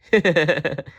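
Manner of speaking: put-on light boyish giggle; a boy joining in the giggling; boy's giggle. A narrator's reading. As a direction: giggle along with the bear. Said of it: "So did Timothy".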